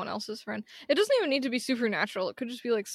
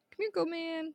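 coaxing softly; 5 semitones higher, 8 dB quieter than usual. Real, not acted.

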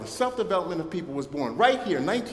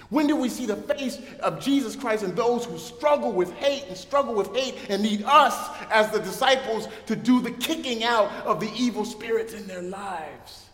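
building, higher preaching voice, slowing at the end; preaching climax; 4 semitones higher than usual. Acted. Sermon climax, rising preaching.

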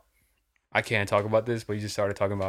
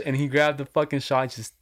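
normal talking voice

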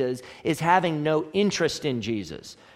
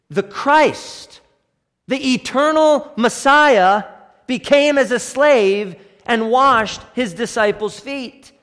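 loud, high, slow preaching build to a peak; preacher hammering home the Messiah became a slave, rising loud; rising preaching force; 6 semitones higher, 7 dB louder, slower than usual. Real, not acted.

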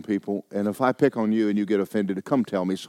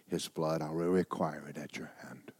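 whisper; 5 semitones lower, 12 dB quieter than usual. Acted. Scripture read to a hush.

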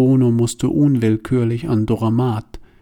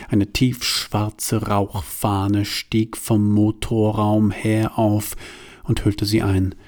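ordinary delivery

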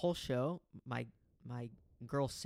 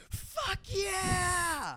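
much higher, loud euphoric yell; hyped shout; 5 dB louder than usual. Retold or imitated. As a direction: scream it like a fan at a punk show. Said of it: "he just goes".

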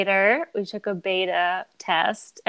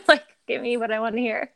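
normal talking voice

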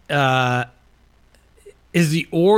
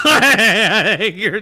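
laughing; 6 semitones higher, 5 dB louder, much slower than usual. Real, not acted. Laughing while teasing.

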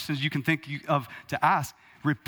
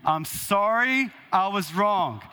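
slow, higher, every word of "I'm sorry I was wrong" spelled out; clear and deliberate; 4 semitones higher, slower than usual. Shown as a demonstration. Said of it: "Some of you mumbled".

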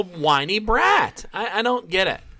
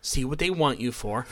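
calm advice; 4 semitones lower, 6 dB quieter than usual. Retold or imitated.

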